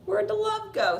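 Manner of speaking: high, loud mock wail; hammed-up exasperation. Disappointed lover asking after the vanished love, exasperated. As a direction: wail the question, comically let down. Repeats the acted outraged question.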